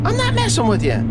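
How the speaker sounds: high mock protest from his wife; imitating wife. Wife's voice in retold quarrel.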